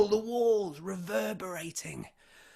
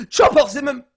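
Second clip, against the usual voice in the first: loud, higher barked order; royal command shout; 8 semitones higher, 13 dB louder, faster than usual. Acted. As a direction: bark the order like a tyrant. King orders execution.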